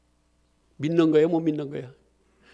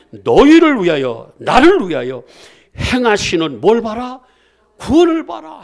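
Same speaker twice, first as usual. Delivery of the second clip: preaching at full force; 3 semitones higher, 11 dB louder than usual. Acted. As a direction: drive each phrase home like a call to faith.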